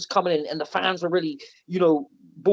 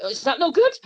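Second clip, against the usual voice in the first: panicked question; 8 semitones higher than usual. Retold or imitated. His own panicked question retold.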